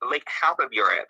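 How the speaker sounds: usual speaking voice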